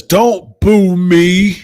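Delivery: hammed-up whiny plea to the crowd; mock Aaron. Streamer mocking Aaron's plea to the crowd, slow and loud. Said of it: "Aaron says".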